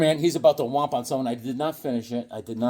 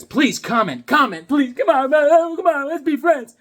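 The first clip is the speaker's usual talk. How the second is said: high, loud, building plea; hammed-up desperate pleading; mock begging; 12 semitones higher, 6 dB louder than usual. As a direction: beg the viewers, climbing higher. Mock begging viewers for comments.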